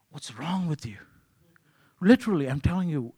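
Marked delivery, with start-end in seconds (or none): 0.1-1.0 whispered inner outburst. Retold or imitated. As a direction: hiss it silently to yourself, irritated. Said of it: "in me boiled was".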